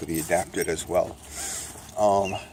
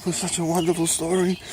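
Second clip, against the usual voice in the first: amazed reader voice; 9 semitones higher than usual. Pretend. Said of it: "I say".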